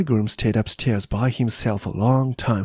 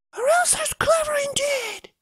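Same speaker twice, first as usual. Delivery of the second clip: put-on boy's voice; 3 dB quieter than usual. Boy marvels at Elsa.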